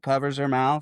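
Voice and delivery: usual speaking voice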